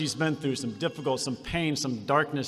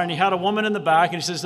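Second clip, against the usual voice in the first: ordinary delivery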